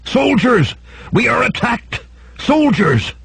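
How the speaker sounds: high-pitched cry of alarm, held back in volume; alarmed shout. A narrator's reading. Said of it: "what words were being shouted".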